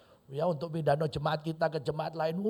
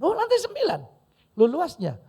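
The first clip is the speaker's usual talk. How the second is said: high, loud incredulous question; acted astonishment; 12 semitones higher, 9 dB louder than usual. Stranger hearing how tall the new church is, astonished. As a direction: burst out amazed, voice shooting way up. Acts donor amazed at church building.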